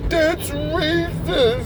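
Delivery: high, drawn-out fake sobbing whine; slow, squeaky crybaby voice; mock crying. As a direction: imitate a woman wailing through tears. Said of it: "she starts crying and she's like".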